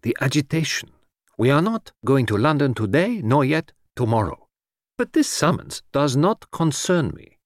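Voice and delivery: calm him, then refuse flatly; Poirot, exasperated and firm. Acted. Detective refuses the summons before his agitated friend, firmly.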